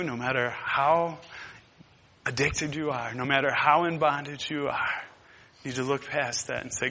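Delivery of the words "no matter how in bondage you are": normal talking voice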